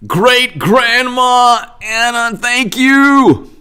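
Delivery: high, drawn-out excited cheer; effusive thanks. Real, not acted. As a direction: call out thanks loudly and gleefully, stretching the words.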